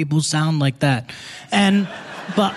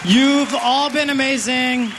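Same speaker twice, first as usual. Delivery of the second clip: high-pitched stage sign-off; calling to crowd; 8 semitones higher than usual. Real, not acted. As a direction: call out your thanks over the applause. Comedian signing off to applauding crowd.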